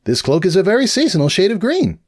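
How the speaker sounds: put-on a bright young mare rambling on; cheerful unicorn scholar voice; Twilight voice.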